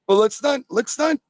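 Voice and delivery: high, climbing indignation; rising outrage. Real, not acted. Caller building his rant to host, high indignant. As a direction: push it up to a peak.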